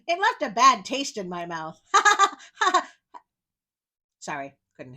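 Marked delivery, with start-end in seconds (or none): 1.9-2.9 big burst of laughter; loud laugh. Real, not acted. Laughs at own joke.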